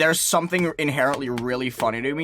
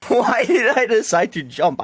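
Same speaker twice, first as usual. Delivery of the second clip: laughing disbelief; 8 semitones higher, 5 dB louder than usual. Real, not acted. Laughing at own mistake.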